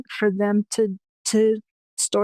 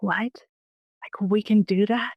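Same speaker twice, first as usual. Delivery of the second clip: stunned whisper. Retold or imitated. Daughter stunned by the news.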